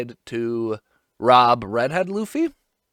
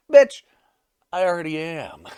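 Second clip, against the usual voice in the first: cocky yell that starts much higher and louder, then drops back; mock yell; 3 semitones higher, 4 dB louder than usual. Shanks yelling back at his young rival, a mock yell. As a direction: bark the first word, then shrug off the rest. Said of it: "so they're yelling at each other".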